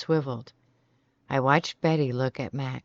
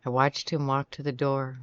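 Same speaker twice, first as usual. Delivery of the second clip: ordinary delivery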